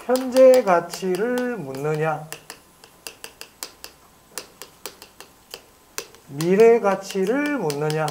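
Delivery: drawn-out, deliberate dictation pace; slow dictation. Real, not acted. Teacher dictating the question for students to write, slow dictation. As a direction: dictate slowly, word by word.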